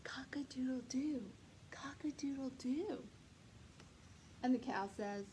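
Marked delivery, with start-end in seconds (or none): 0.0-3.0 a tiny breathless whisper of a crow. Acted. Rooster losing his voice, whispered crow. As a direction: rooster trying to crow but only whispers.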